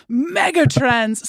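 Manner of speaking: big truck show announcer. Acted. Host hamming up a buzzword for her guest, truck-show announcer voice. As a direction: hype it like a truck rally ad, drawn out. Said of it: "those big trucks, you know".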